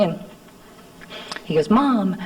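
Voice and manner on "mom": put-on a higher, small boy calling "Mom"; little child's voice. Small son in footed jammies calls his mother, higher little voice. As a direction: give it the tiny voice of a toddler. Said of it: "just that little voice they have".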